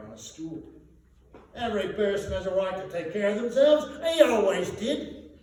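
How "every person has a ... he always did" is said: high, loud brazen cockney woman; flaunting charwoman. A narrator's reading. Charwoman to the thieves, high brazen flaunting voice. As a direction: flaunt it, shameless and defiant. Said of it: "The charwoman threw her bundle ... in a flaunting manner".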